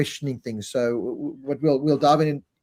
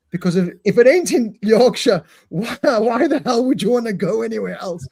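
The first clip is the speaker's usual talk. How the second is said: higher, louder proud Yorkshire pilot, laughing; 8 semitones higher, 5 dB louder than usual. Retold or imitated. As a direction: play up the Yorkshire pride, laughing. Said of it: "And then the pilot says".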